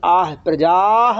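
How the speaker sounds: आह, प्रजाः slowly with the final visarga let out as a breath, like आह; breathy visarga. Shown as a demonstration. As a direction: end प्रजाः on an audible aspirated ह. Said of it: "वैसे ही निकलना चाहिए".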